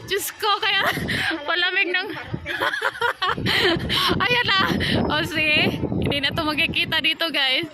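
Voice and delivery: squealing with delight; excited laughing shouts. Real, not acted. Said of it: "Tuwang-tuwa ako sa fog eh".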